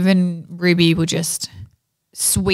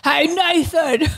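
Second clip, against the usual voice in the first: slow, higher mock Aussie "Hey, Nathan"; Aussie greeting; 7 semitones higher, slower than usual. Reading a fan's name in a joking Aussie accent. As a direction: stretch it out like a broad Aussie bloke. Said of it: "That's Aussie, eh?".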